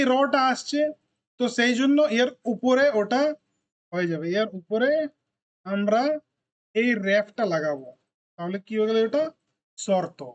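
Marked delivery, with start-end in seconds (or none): none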